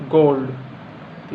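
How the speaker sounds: गोल्ड said very slowly, the ओ held long; long dragged ओ in गोल्ड; long O sound. Shown as a demonstration. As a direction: hold the ओ in गोल्ड, drawn right out. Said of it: "थोड़ा सा लंबा होगा".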